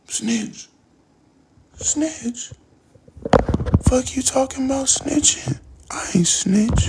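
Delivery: high, squeaky mock outrage; mock denial. The informant denying he snitched, high and defensive. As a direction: play the guilty guy acting shocked.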